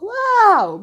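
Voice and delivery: drawn high, loud "wow"; retold amazement. Retold amazement at own brain.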